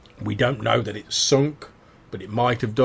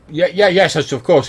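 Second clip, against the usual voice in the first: hasty eager agreement; 8 semitones higher, 9 dB louder than usual. Acted. Cartwright hastily agrees.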